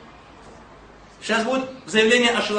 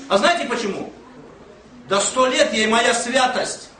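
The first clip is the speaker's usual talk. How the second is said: ordinary talk, nothing marked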